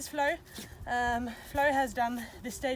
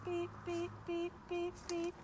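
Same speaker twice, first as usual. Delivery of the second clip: high, quiet beeping cue to the dog; 7 semitones higher, 5 dB quieter, slower than usual. Shown as a demonstration. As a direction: chirp the beeps softly and high, like a signal. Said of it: "when I beep, she backs up".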